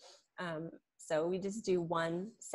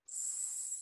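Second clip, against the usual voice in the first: long unvoiced hissing s; drawn-out s like a snake; 11 dB quieter than usual. Shown as a demonstration. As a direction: hiss it out like a snake. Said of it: "like snake".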